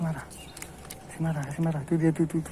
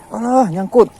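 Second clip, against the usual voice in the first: loud, high alarmed cries; loud and high, urgent; excited calling; 8 semitones higher, 14 dB louder, slower than usual. Real, not acted. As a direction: call out as the line snags. Line snags, calling out.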